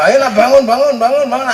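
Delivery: calling out. Acted. Parent waking the children.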